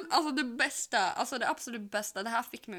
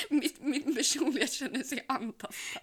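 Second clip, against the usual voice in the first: laughing; 3 semitones higher, 4 dB louder, slower than usual. Real, not acted. Joking that she feels harassed.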